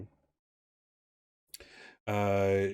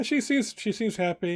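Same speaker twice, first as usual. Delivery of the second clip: waitress voice; 11 semitones higher than usual. Acted.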